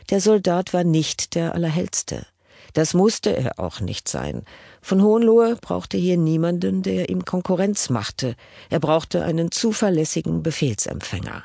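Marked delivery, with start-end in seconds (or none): none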